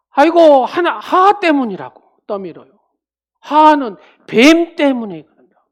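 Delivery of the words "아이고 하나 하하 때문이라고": higher, loud whining blame; whining Adam. Retold or imitated. Adam groaning and blaming Eve, high and loud. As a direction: groan then shove the blame. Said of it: "아담은".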